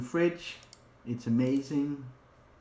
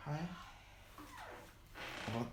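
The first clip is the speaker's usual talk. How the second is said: normal talking voice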